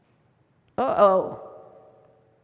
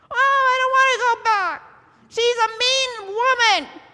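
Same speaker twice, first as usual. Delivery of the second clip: high, fast whining Hagar; high whiny Hagar refusing the angel; 8 semitones higher than usual. Retold or imitated. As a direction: whine like a runaway maid. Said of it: "an angel comes and says".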